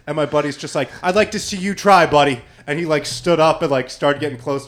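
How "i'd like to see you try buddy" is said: retold threat.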